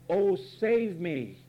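slow, drawn-out plea of "Oh, save me.". Shown as a demonstration. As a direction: beg "save" slowly, stretched out. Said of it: "Hosanna means".

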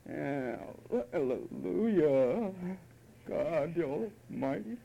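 slow, wobbly mock praise; feeble, bleating worship for show; whining voice. Shown as a demonstration. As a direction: drag the praise out in a pathetic whine. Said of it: "with authority in a whining voice".

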